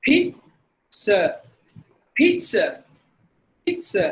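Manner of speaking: pizza as PEET-suh, pitched high; crisp ts in pizza; no Z sound. Shown as a demonstration. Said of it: "That's how we have to pronounce".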